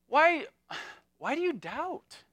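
usual speaking voice

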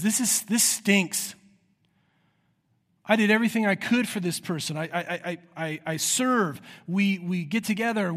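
frustrated lament. Acted. Preacher voicing a spouse crying out about a failing marriage, exasperated. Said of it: "No, it says".

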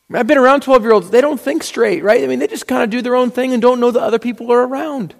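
high, fast, amused exasperation; exasperated. Real, not acted. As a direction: rattle it off like a tired parent. Exasperated about twelve-year-olds.

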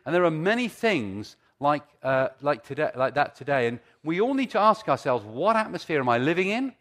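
loud, driving preacher voice; preaching. Acted. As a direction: build it up like a preacher. Preaching at full force.

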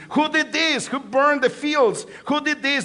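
angry Philistines demanding who burned the fields. A narrator's reading. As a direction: demand an answer from the crowd. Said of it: "The Philistines demanded".

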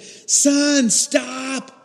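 high, loud warning shout; fearful scream. Retold or imitated. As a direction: scream to stop your son before a car hits. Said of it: "and I screamed. I was fearful".

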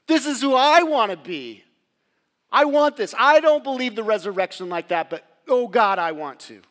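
loud, high yearning cry; crying out with longing; impassioned cry. Real, not acted. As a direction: preach it out at full force, longing. Preacher's passionate outburst.

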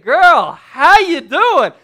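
playful over-the-top come-on; smitten Adam. Acted. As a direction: call out hammed-up delight. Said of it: "he's basically saying".

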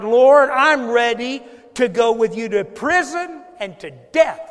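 loud, high declaration, drawn out; Peter's bold vow. Acted. Peter pledging loyalty to the Lord, loud and bold. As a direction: proclaim it at full force, slowly. Preacher quotes Peter's boast to Jesus.

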